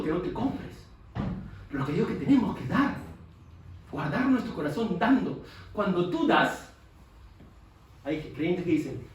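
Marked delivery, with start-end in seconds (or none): none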